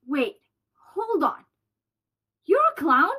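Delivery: ordinary delivery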